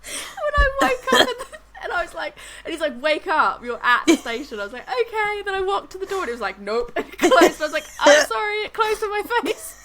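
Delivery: high, squeaky voice breaking into laughter; laughing through the telling. Real, not acted. Woman retelling falling asleep on the train, laughing helplessly. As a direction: struggle to get the words out through real laughter.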